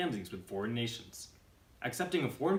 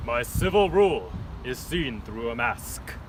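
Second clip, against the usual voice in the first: dictator voice; 2 semitones higher, 8 dB louder than usual. Acted. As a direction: brood like a masked tyrant. Dictator reflecting.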